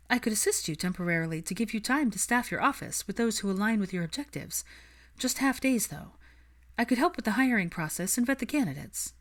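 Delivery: lifted, brisk and businesslike; Hermione, eager offer. Acted. Hermione voiced in story.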